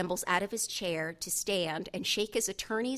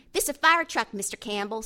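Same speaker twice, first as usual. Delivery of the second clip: put-on higher, louder little-boy voice; excited young Jordan; 3 semitones higher, 9 dB louder than usual. A narrator's reading. Seven-year-old shows the lawyer his coloring, excited child voice. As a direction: show off like a proud small boy.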